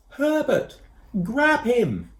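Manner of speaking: high, slow, loud call; shouting. A narrator's reading. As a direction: call up from far below. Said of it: "Koo shouted".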